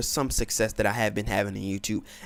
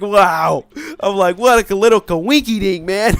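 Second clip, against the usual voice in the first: loud sarcastic wow, what a coinky dink; mock surprise; 5 semitones higher, 9 dB louder than usual. Gamer retelling meeting his rival again, loud sarcastic. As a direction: play it like a fake casual surprise.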